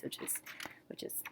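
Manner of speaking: whispered repeat, barely voiced. Shown as a demonstration. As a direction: breathe the words with no voice.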